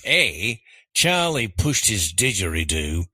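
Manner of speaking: hammed-up Australian accent. Quiz contestant reading choices on request, Australian accent. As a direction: read it out in your best Australian accent. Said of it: "read these choices in your best Australian accent".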